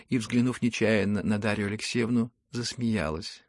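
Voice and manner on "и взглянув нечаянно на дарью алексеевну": ordinary delivery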